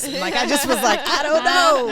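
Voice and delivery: loud, high laugh through the words. Real, not acted. Telling the host about film school, laughing loudly. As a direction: laugh at your own cluelessness.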